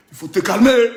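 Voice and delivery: sudden forceful burst; loud warning. Acted. Ordering the rival to calm down, suddenly loud. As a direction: belt out the warning.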